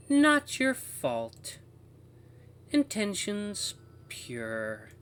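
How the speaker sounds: high, very slow, broken phrases; high falsetto, words parted like clipped audio; choppy woman's voice. Acted. Walkie voice absolves the narrator, choppy high woman's voice. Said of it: "words start coming out even choppier".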